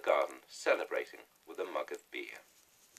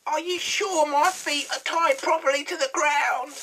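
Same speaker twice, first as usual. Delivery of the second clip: shrill witch voice, much higher and louder; gasping hag; 13 dB louder than usual. A narrator's reading. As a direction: squawk it like a panicky old hag. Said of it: "she gasped".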